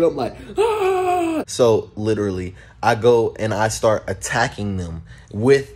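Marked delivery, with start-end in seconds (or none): 0.6-1.4 high held charging ah; battle cry. Retold or imitated. Boy charging his friends with an icicle, high battle cry. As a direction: scream as you charge in.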